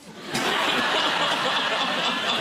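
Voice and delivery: high giggling fit; high laughter. Real, not acted. Guest cracking up over the monkeys, giggling fit.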